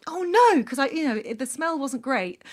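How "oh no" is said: higher, louder mock alarm; retold dismay. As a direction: recall the sinking realisation. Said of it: "And I just thought".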